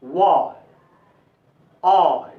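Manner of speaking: वा and आई with a long "aa" gliding into brief "i"; lingering first vowel. Shown as a demonstration. Teacher models the letter Y, drawn-out वा before a short ending. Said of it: "वा पे ज्यादा रुकना है".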